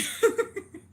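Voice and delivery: real high-pitched giggling; high laugh. Real, not acted. Streamer tickled by a viewer's comment, high laugh.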